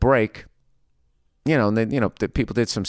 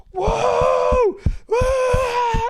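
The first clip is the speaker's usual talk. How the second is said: mock crowd whooping, very high-pitched; high, drawn-out mock cheers; over-the-top cheering. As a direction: whoop like a ridiculously enthusiastic class. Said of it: "ridiculously loudly starts cheering".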